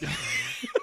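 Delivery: laugh. Real, not acted. Cracking up at own joke.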